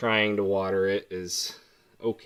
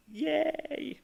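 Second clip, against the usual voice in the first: sarcastic yay; 5 dB quieter than usual. Real, not acted. Sarcastic cheer about mowing lawn.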